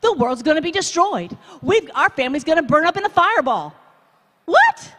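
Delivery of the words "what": sharp, high, loud disbelief; a shocked yelp of disbelief; incredulous what. Acted. Preacher mocking doom talk, shocked and loud.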